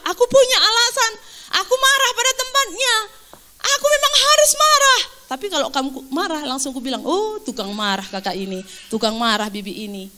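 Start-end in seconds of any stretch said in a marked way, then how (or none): 0.1-5.0 high-pitched, insistent self-justification; indignant self-defence. Acted. Preacher mimics someone excusing their own anger, indignantly. 7.0-10.1 tag. Acted. Acting a dismissive judgement of others.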